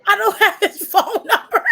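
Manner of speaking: laughing protest. Real, not acted. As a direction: say it through a laugh.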